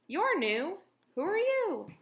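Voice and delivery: rabbit voice. A narrator's reading. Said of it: "said a rabbit".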